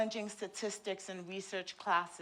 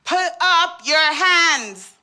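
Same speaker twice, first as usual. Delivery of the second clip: loud, slow, high commanding shout; barked orders; 8 semitones higher, 14 dB louder, slower than usual. Acted. Officer ordering a new patient through intake, loud drawn-out commands. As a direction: draw out each order at full volume.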